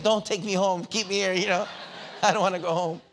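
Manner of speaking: high, loud mock child begging; hammed-up little boy crying out not to go home; pleading child. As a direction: play the scared kid pleading, voice up high and loud.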